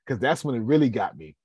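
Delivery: ordinary delivery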